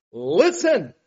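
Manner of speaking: loud, firm call to listen; commanding listen. Shown as a demonstration. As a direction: call the crowd to attention. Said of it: "Jesus says it like this".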